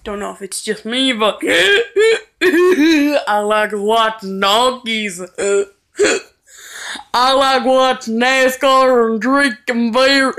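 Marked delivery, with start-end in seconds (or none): 1.1-10.4 giggling through the words; laughing. Real, not acted. Vlogger laughing at own silly confessions to viewers. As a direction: crack up while confessing.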